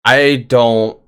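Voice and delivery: normal talking voice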